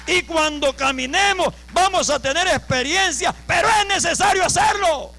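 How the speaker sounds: loud exhortation climbing in pitch; full-force preaching. Real, not acted. Preacher urging congregation to gain experience, full-force preaching. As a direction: push it to a high, urgent peak.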